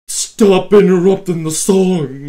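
loud cry of annoyance at himself; frustrated outburst. Real, not acted. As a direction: blurt it out in a burst of frustration. Frustrated at pausing the song.

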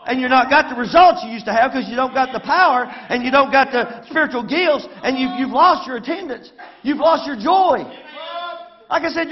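fiery sermon delivery; preaching at full force. Acted. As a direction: preach it hard at the congregation. Preaching to the congregation.

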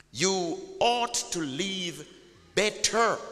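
usual speaking voice

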